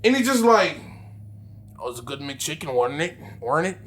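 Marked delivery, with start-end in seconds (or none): 1.8-3.8 creepy low murmur. Retold or imitated. Creepy driver voiced in story.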